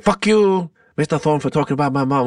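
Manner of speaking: put-on quick Filipino accent outburst. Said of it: "he's doing, like, the Filipino accent".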